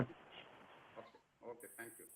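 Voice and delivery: faint, far from the mic; speaking far too softly; barely audible. Real, not acted. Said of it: "Sir, your voice is too low".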